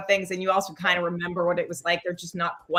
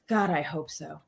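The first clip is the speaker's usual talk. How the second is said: deadpan; 6 semitones lower, 6 dB quieter than usual. Retold or imitated. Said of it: "deadpan looks at her and she goes".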